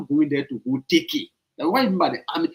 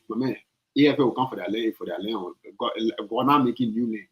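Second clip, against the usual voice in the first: mocking the president; 7 semitones lower, 3 dB quieter than usual. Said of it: "Who talk like that?".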